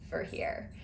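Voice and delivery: whispered "For here", barely voiced; whispered reply. Whispering back to the barista. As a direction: breathe the words out. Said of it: "I'm like".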